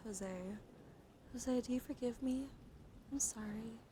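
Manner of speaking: usual speaking voice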